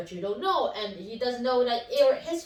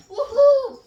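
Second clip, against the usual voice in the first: excited whoop; 11 semitones higher, 13 dB louder than usual. Real, not acted. Cheering at end of video.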